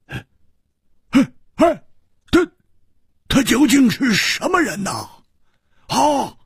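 high, stammering, frightened woman; anxious woman's cry. Acted. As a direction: blurt it out high and alarmed, tripping over words. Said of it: "忍不住说". (蝴蝶花忍不住惊慌追问)

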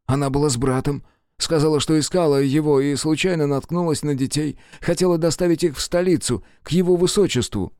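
rising pitch as he pleads; nervous prisoner. Acted. Jacob continues.

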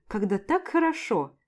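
ordinary delivery

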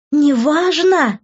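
high, drawn-out incredulous echo; hysterical indignation. A narrator's reading. Daughter throwing mother's words back, hysterical indignation. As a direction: stretch it into a shrieky question. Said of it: "истерически возмутилась я".

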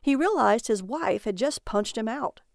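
ordinary delivery

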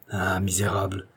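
hushed curse through gritted teeth. A narrator's reading. Isidore curses Drouet, through gritted teeth. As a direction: seethe under your breath. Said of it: "s'écrie Isidore en grinçant des dents".

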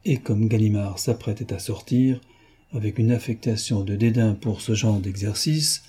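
usual speaking voice

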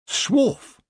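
high-pitched word of approval; approving. A narrator's reading. Nanny Ogg watches the attack, approving. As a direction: voice it high, with relish. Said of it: "said Nanny Ogg approvingly".

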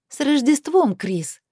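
high, mock-festive jibe; caustic drawl. A narrator's reading. Heroine needling Chris before hanging up, caustic. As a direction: sing out the greeting sarcastically, pitched high. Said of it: "протянула язвительно".

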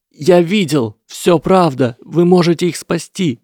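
high, loud insistent cries; desperate shouting. A narrator's reading. Lesath insists Harry can save his parents, shouting desperately. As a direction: insist through despair.

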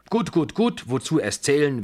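very high, loud rapid-fire brush-off; shrill-pitched hurried protest; impatient dismissal. A narrator's reading. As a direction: cut off the counting impatiently. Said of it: "wehrte sie mit den Händen ab".

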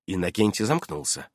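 plain reading voice

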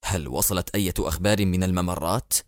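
steady his grief and stay resolute; grave president. Acted. President speaking to his minister about his dead brother, low and controlled.

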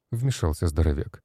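plain reading voice

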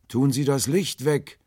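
high, loud, drawn-out cry; shouted plea. A narrator's reading. Stranger in the boat begs for darkness, crying out loudly. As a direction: call it urgently from far away.

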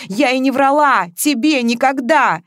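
heated and defensive; sharp retort. A narrator's reading. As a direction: answer back sharply. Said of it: "резко отвечала Маша".

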